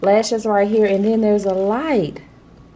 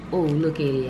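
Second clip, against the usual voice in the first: normal talking voice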